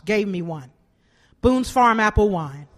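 ordinary talk

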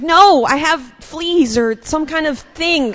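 high, slow whiny insistence; mock protest. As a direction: plead it like you're sure it's fleas. Her protest retold.

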